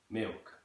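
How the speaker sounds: "Milk" with L dropped, "miwk"; East London pronunciation. Shown as a demonstration. Teacher demonstrating East London, "Milk" with no L. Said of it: "just dropping the L there entirely".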